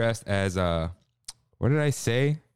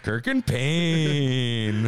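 slow dramatic announcement; much slower than usual. Acted.

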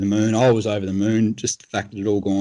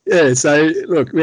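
laughing; 4 semitones higher, 5 dB louder, slower than usual. Real, not acted. Laughing after the stag story.

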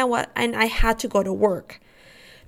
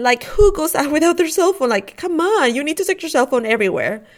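higher, louder exasperated griping; exasperated scolding; 5 semitones higher, 4 dB louder than usual. Retold or imitated. Wife griping about husband without cell phone, exasperated. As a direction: scold in disbelief, pitch jumping up.

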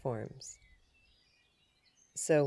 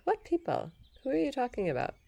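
much higher, baffled asking; incredulous question; 10 semitones higher, faster than usual. Retold or imitated. Pressing the fake supervisor on the phone, baffled and much higher. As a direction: demand to know who he means.

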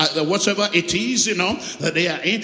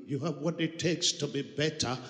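a hint of the network's recorded message, softer; phone recording voice; 5 semitones lower, 10 dB quieter than usual. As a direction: lightly mimic the ringback slogan. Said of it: "that voice recording".

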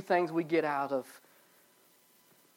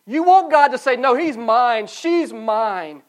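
loud, higher preacher's declaration; preaching; 7 semitones higher, 13 dB louder, slower than usual. Acted. Pastor telling congregation God claims them, fervent preaching. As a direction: proclaim it with full fervor.

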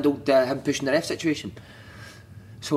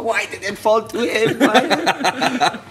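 high, slow imitated wail; mock despair; 7 semitones higher, 4 dB louder, much slower than usual. Teammate watching a missed chance, mock despairing wail. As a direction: lament the miss in hammed-up disbelief.

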